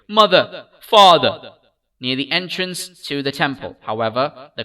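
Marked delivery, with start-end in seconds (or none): 0.1-1.5 crying out for mother and father, loud; a lost child's tearful cry; sobbing call. A narrator's reading. Lost child calling for his parents, sobbing loudly. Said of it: "his little sob lingering".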